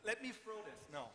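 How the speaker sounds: usual speaking voice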